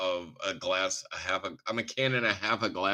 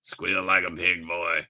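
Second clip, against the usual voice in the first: movie hillbilly quote; 2 semitones lower, 7 dB louder than usual. Acted. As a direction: quote a backwoods movie villain. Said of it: "that went a different direction".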